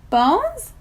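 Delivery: sudden high startled yell. A narrator's reading. Mac yelling in alarm about bones. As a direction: blurt it out loud in alarm. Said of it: "Mac yells".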